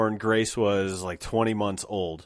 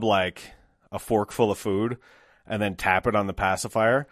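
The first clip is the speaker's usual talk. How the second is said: normal talking voice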